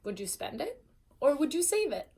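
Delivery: ordinary delivery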